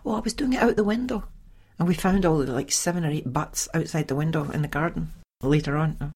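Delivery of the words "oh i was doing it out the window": quick, high, airy excuse; mock lodger excuse. Lodger brushing off the smoking, high and fast.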